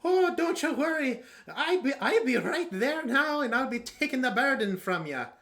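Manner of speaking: high, loud, put-on character accent; Cather accent. Game master demonstrates Cather taking the burden, high accented voice. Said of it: "I can't do a Cather accent".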